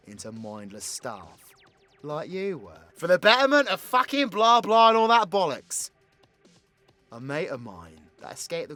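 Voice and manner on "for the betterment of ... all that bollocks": loud, high mock rallying cry; raised-voice sarcastic recital; mocking slogan. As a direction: belt out the slogan with scorn.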